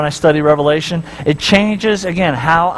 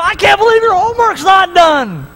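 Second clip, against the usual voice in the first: high, exasperated complaint; mock angry nagging; 13 semitones higher than usual. Pretend parent coming home complaining, high and irritated. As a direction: nag about the shoes, hammed up. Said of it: "You come home and go".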